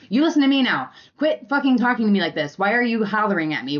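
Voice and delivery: loud, high, fast scolding; telling him off. Retold or imitated. Wife yelling back at partner in a basement fight, loud and sharp. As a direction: snap back at him forcefully. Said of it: "that is how I would talk to him".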